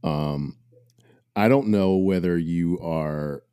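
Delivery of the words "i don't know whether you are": ordinary talk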